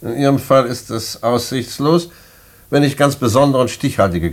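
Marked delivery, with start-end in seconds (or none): none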